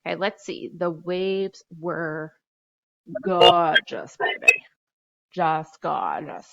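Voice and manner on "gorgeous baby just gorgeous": put-on gorgeous, baby, belted out louder with sass; sassy emphasis. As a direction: sell the new word like a diva. Teacher savoring new word choice.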